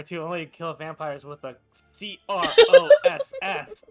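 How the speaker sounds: ordinary talk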